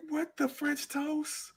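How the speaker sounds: high, soft, slowed baffled squeak; drawn-out bewildered kid question. Retold or imitated. Kid stunned by the quake, high and slowed bewilderment. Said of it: "I was like".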